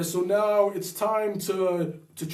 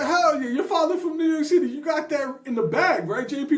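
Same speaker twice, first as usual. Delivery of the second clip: high, fast New York voice; New York City accent; 8 semitones higher, 3 dB louder than usual. Retold or imitated. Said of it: "Oh, you said".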